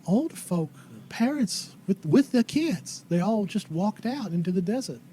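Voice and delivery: put-on folksy old lady, haltingly telling what happened; old townswoman. Old lady telling visitors how everybody left, slow rustic drawl.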